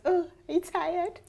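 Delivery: a light, laughing put-on American accent; mock American accent. Teasing a tired guy at camp in a light mock American accent. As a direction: tease him in a playful American accent. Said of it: "Oh, American accent".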